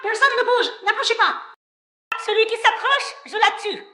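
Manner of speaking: high-pitched, frantic warning; hostage threat. Acted. Hostage taker warning everyone back, frantic threat. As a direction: threaten them in a high, desperate voice.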